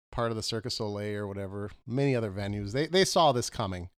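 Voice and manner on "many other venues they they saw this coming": ordinary talk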